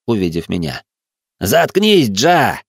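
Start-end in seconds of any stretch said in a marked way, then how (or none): 1.4-2.6 loud, raised-pitch order to shut up; angry snap. Acted. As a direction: cut him off sharply. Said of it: "бросил Хэнк". (злой громкий окрик)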